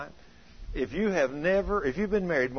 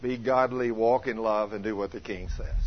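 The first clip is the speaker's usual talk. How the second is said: ordinary delivery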